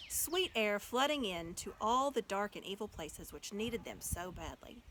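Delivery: plain reading voice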